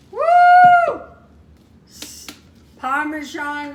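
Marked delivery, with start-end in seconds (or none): 0.1-1.0 high whooping cheer; joyful woo of a thrilled cook; excited whoop. Real, not acted. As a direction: let out a delighted whoop. Thrilled by the cooked food.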